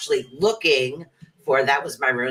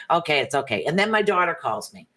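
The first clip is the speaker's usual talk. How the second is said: ordinary talk, nothing marked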